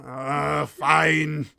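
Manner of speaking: loud grudging sigh of defeat. Acted. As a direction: give in loudly and grudgingly. Ferryman grudgingly gives in.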